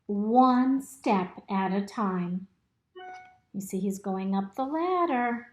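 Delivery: usual speaking voice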